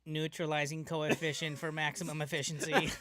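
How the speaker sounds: loud, high robotic alert; robot alarm voice. Pretend. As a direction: report it like a security android. Robot detecting forbidden substance.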